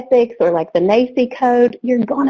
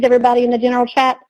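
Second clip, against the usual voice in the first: normal talking voice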